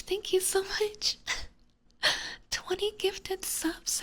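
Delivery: overjoyed at twenty gifted subs, gushing high; too excited. Real, not acted. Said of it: "I'm just too excited".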